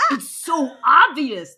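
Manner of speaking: loud, drawn-out exasperation; exasperated outburst. Acted. Squat wizard tells the clueless narrator, loudly exasperated. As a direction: burst out loudly, stretching the words.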